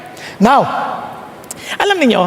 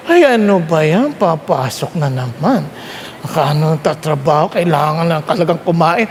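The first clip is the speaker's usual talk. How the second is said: groggy grumbling; 9 semitones lower than usual. Retold or imitated. Preacher imitating a worker dreading the workday, low groggy grumble. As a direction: sigh it out like someone dragging themselves out of bed.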